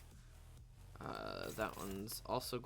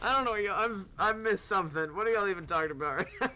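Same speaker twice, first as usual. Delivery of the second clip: loud, high, laughing through the words; can't believe what chat is saying; laughing disbelief; 9 semitones higher, 12 dB louder, faster than usual. Real, not acted. Streamer reacting to chat's talk, laughing loudly.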